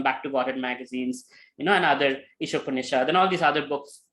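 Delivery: ordinary talk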